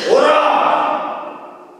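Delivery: slow, drawn-out shouted command; booming summons, high and loud; commanding call. Acted. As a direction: call him across the water, stretching each word. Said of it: "예수님께서 베드로에게 뭐라고 그랬습니까".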